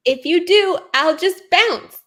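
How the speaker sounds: usual speaking voice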